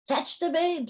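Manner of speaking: high-pitched royal order; urgent command. A narrator's reading. King sends fairies to fetch the babe, urgent command. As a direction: call out the order, pitched well up.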